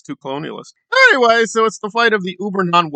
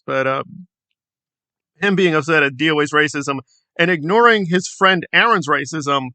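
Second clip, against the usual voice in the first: usual speaking voice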